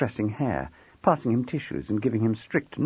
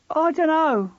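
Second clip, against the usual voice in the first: put-on higher, louder boy insisting; louder, insistent; 5 dB louder, slower than usual. A narrator's reading. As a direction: dig in and push back louder. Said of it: "getting louder".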